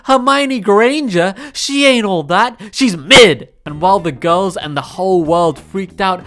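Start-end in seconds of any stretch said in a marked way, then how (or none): 0.0-3.5 taunting rant. Acted. Ranting against Potter fans.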